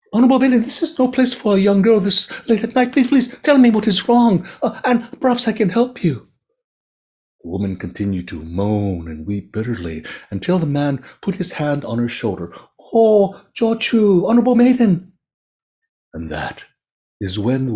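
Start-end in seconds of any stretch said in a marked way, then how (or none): 0.1-6.3 put-on much higher, louder, begging the weeping girl; high pleading merchant. Merchant begs the crying maiden to talk, high pleading. 12.9-15.1 put-on much higher, louder, begging the weeping girl; high pleading merchant. Merchant begs the crying maiden to talk, high pleading. Merchant touches her shoulder.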